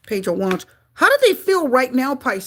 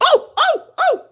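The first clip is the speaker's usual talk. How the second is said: high, louder startled cries; shocked exclamation; 5 dB louder, slower than usual. Real, not acted. Reader seeing the feared card turn up, shocked cries.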